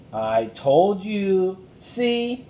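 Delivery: high, loud, slow, breathless; triumphant and out of breath; panting girl. Acted. Annie showing Jack the tree, panting.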